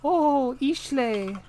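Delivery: high, loud mock exclamation; hammed-up imitation of someone talking in their sleep; imitating a sleep-talker. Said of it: "I can't imitate you very well".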